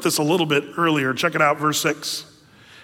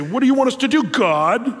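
rebellious whine; 6 semitones higher, 3 dB louder than usual. Retold or imitated. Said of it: "it's a bad attitude from the people".

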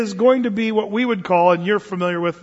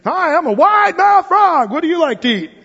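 loud, high, stretched-out joke frog voice; wide-mouth frog; 10 semitones higher, 6 dB louder than usual. Acted. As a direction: belt it out as a big-mouthed cartoon frog. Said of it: "the wide mouth frog would walk around".

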